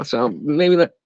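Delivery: usual speaking voice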